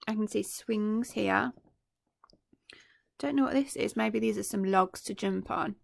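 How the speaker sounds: ordinary delivery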